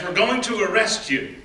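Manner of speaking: ordinary delivery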